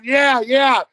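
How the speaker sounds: loud, high, urgent yelps; mock-panicked shouting; retold desperate yes. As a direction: act out frantically agreeing to give up. Acts desperate yes to referee.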